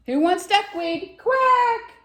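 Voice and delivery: high, loud duck shout; excited high-pitched duck call. Acted. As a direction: call it out big, ending in a squawky quack. Duck calls out to friends.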